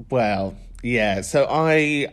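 normal talking voice

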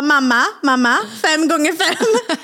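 put-on high, slow, drawn-out child quizzing. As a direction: drag it out like an eager kid. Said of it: "Han bara". (högt, långsamt barn som förhör)

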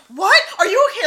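high, loud panicked "what, are you okay"; worried gasp at the news; alarmed. Retold or imitated. Friend hearing of an injury, high and alarmed. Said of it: "I was like".

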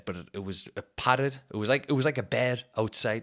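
ordinary delivery

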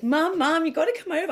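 high, loud frantic plea; urgent, rising alarm, calling for help; panicked call to mum. Retold or imitated. Calling mum over about the missing cat, frantic.

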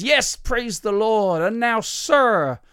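very slow, high American preacher; grand American revivalist proclamation; slight American accent. Acted. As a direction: stretch every phrase like an American faith healer. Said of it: "that's a slight American accent".

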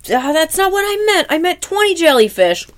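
high, loud angry shout. Retold or imitated. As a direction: yell it in a high voice. Kevin shouting at SpongeBob.

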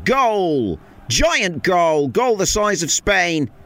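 roaring goal call, high and loud; frantic mock sports commentary. Shown as a demonstration. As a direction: bellow it into the mic. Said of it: "maybe just a touch".

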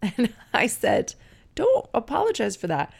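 laughing through the reply. Real, not acted. Mother laughing as she reassures her daughter. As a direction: chuckle out the words, amused.

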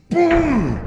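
long, booming "pum" with falling pitch; big-bang impact noise. Acted. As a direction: imitate a colossal hammer blow. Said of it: "un trancazo digno de un Big Bang".

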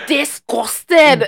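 drawn-out, high, loud "disgusting"; disgusted shriek. Retold or imitated. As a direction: stretch it out in shrill disgust. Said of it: "Every time I saw her face".

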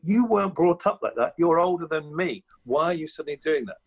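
incredulous, scolding the parents; mild mock outrage at copycat parents; exasperated inner voice. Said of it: "And you think".

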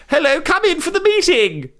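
high mock royal voice; the Queen. Host plays the Queen welcoming Boris, high mock royal voice. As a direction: play the Queen greeting a guest, pitched well up. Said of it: "I'll be the Queen".

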